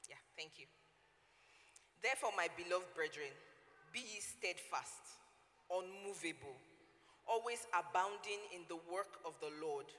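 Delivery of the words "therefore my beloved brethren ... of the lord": louder, measured Bible reading; solemn King James reading; scripture reading. Acted. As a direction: read the verse with solemn weight. Reading scripture aloud KJV.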